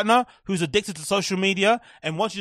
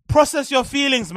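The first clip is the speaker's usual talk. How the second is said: ordinary delivery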